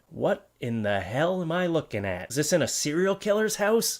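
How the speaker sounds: usual speaking voice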